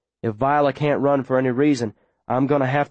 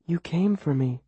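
quiet tender wonder; softly moved; 4 semitones higher, 5 dB quieter than usual. Acted. Girl to the boy who rescued her, tender. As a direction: say it softly, touched.

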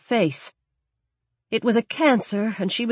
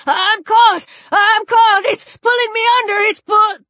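high loud panicked scream; 14 semitones higher, 6 dB louder than usual. Acted. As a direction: cry out as it drags you under. Drowning man screaming for help.

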